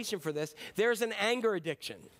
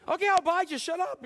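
much louder and higher, a fed-up snap; exasperated give-in; 10 semitones higher, 6 dB louder than usual. Retold or imitated. Worn-out customer shouting at the yelling ad, exasperated. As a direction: surrender to the noise, loudly.

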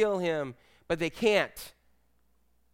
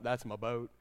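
low, soft simpleton-style impression; Forrest Gump; 8 semitones lower, 11 dB quieter than usual. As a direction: say it low and quiet, in character. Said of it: "Kinda like Forrest Gump".

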